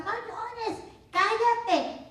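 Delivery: a mocking impression of an impatient parent shushing tears; mock scolding. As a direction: imitate a parent hushing a crying child. Parents scolding crying children.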